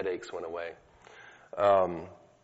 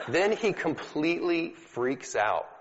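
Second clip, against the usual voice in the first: laughing; 8 semitones higher than usual. Real, not acted. Laughing while telling the healing story.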